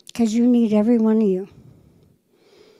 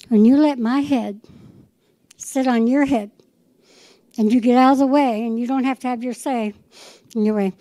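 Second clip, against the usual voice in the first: usual speaking voice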